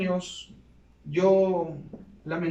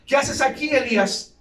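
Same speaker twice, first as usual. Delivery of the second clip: raised stern question from God; 6 semitones higher, 7 dB louder than usual. Retold or imitated. God questioning Elijah in the cave, raised and stern. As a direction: ask it like a demanding Lord.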